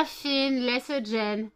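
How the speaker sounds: slow loud call to the cat; yelling across the room. Real, not acted. Calling the cat off a guest.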